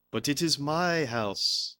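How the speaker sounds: Esteban Winsmore. Retold or imitated. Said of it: "calmly talks about it".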